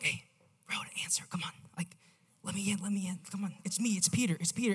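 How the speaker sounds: hushed, urgent whisper at the door; a hiding man whispering to be let in; discreet whisper. Acted. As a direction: keep it down, you're a fugitive. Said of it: "He's at the door like".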